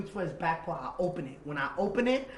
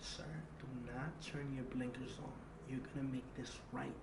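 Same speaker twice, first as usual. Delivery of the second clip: much softer, tense instructions; hushed plea; 3 semitones lower, 12 dB quieter than usual. Retold or imitated. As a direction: keep it down so no one outside hears. Hushed instructions hiding from shooters.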